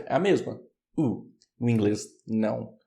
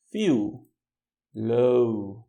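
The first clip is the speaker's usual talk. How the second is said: ordinary delivery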